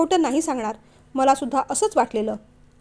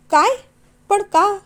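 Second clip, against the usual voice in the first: high, louder stunned काय, पण का; shocked disbelief; 7 semitones higher, 6 dB louder, slower than usual. Acted. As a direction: gasp it out in disbelief. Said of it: "काळीज चिरावं एवढं दुःख".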